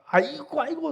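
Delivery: high, loud mock wailing of children in pain; wailing kids. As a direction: wail "aigo" like kids with freezing feet. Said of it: "소리만 나".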